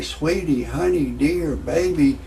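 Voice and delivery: normal talking voice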